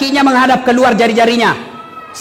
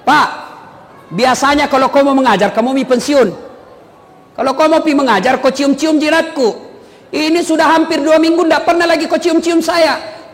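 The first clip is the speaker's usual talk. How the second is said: complaining wife; 6 semitones higher than usual. Retold or imitated. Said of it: "Diprotes sama istrinya".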